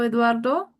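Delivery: usual speaking voice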